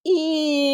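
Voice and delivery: high, drawn-out eeeeow; jet flying over. Shown as a demonstration. Reading aloud, swooping high like a passing jet. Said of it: "A jet goes".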